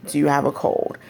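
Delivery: usual speaking voice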